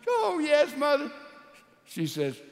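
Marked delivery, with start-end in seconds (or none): 0.1-1.1 high weepy yes; tearful admission. Acted. Daughter admitting the first fight to her mother, high and weepy.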